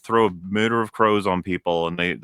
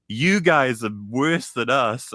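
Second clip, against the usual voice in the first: mock villain line; 5 semitones higher, slower than usual. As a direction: ham up a toothless game villain, slowly. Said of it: "They'll just have them be like".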